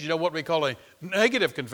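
ordinary talk, nothing marked

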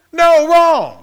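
a preacher's booming no; emphatic shout. Real, not acted. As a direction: shout it down, loud and high. Preacher rebuts the skeptic.

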